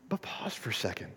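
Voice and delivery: very soft, low, whispered pause for thought; hushed aside. Real, not acted. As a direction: drop to a near-whisper. Hushes the room for reflection.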